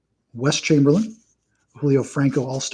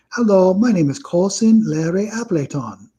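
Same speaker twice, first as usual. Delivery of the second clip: put-on higher-pitched comic foreign sitcom accent; Balky's accent; 5 semitones higher than usual. Amnesiac cousin repeating his name, in a mock foreign accent. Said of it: "in Balky's accent".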